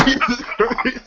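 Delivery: ordinary delivery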